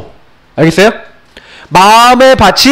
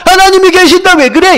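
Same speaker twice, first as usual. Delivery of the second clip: imitating a scoff, pitched clearly higher; mock scoffing; 8 semitones higher than usual. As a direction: jeer like a doubter making fun. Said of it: "이런다니까요". (높은 목소리로 비웃는 흉내)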